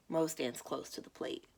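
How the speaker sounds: usual speaking voice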